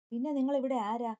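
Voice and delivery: daughter-in-law snapping at her mother-in-law, angry. A narrator's reading. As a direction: fire the question back at her. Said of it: "സ്വര ദേഷ്യത്തിൽ ചോദിച്ചു".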